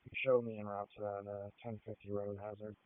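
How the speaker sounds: officer's voice over a radio channel; transmitted through a handheld police radio. Real, not acted. Unit radioing en route status.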